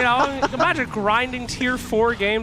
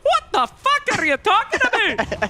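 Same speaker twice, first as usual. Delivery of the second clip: put-on higher, angry Scottish-Irish accent; mock Scottish-Irish accent; 8 semitones higher than usual. Caster imitating the Luna voice actress ranting in a Scottish-Irish accent. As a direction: rant as an offended voice actress. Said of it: "she's like".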